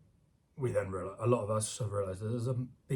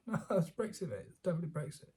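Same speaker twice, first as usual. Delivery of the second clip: higher, mocking insistence; 6 semitones higher, 5 dB quieter, faster than usual. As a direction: parody a know-it-all. Mocking Remainers insisting on Brexit.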